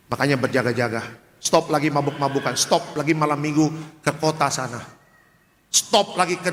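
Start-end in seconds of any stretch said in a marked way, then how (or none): none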